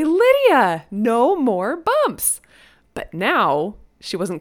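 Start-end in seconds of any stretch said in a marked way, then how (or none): 0.0-2.4 playful catchphrase. Retold or imitated. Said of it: "I say".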